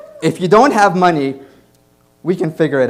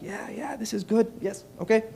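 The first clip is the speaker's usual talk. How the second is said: faking expertise; 7 semitones higher, 9 dB quieter than usual. Retold or imitated. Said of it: "I pretend like".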